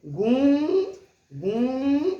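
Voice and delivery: गूंजती with the ऊं ringing through both mouth and nose, loud; गूं said loudly with an open nasal vowel; nasal vowel demo. Shown as a demonstration. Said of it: "नाक से और मुंह से दोनों से आवाज आ रही है".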